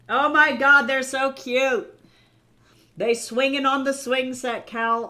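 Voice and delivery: high and loud, stretched out in delight; gushing over cute fan art; excited squeal. Real, not acted. Streamer squealing to chat over cute art, excited.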